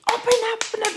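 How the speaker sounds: high, loud retold demand; aggressive demand. Woman banging on the locked door, aggressive demand. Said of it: "I'm getting aggressive".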